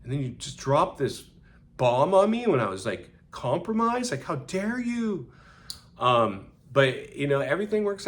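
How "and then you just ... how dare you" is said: unsaid hurt outburst. Retold or imitated. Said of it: "'cause I wanted to say".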